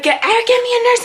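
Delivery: high, urgent imitation of mom; stammered high-pitched plea; panicked mom. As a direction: act out mom urgently calling for help. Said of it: "My mom runs out the room".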